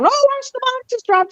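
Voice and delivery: imitating the excited newsman on TV; reporter quote. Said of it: "hanging out of that hotel going".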